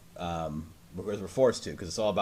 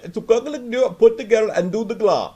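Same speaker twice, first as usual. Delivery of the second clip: high, loud garbled gibberish; mock a technician nobody can understand; unintelligible tech worker; 10 semitones higher, 8 dB louder than usual. Tech worker giving garbled instructions, high mocking gibberish. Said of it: "their communication skills ... are awful".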